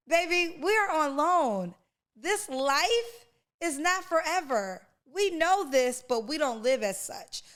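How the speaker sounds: sermon-like urgency, voice lifted high. Real, not acted.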